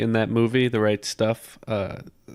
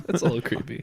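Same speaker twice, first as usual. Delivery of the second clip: amused laughing; 8 semitones higher, faster than usual. Real, not acted. Laughing at a creepy story.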